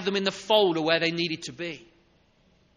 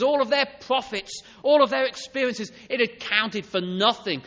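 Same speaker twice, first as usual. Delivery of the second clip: rising, louder preacher's voice, hammering each all of their; fervent preaching; 3 semitones higher, 7 dB louder than usual. Acted. Preacher to congregation, building in loud fervent emphasis. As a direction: preach it out, building with every phrase.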